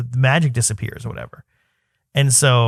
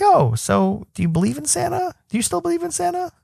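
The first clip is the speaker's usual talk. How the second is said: much higher, tentative sing-out of a question; feigned casual question. Retold or imitated. Dad broaching Santa with his son, high feigned casualness. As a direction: ask it high, testing the water.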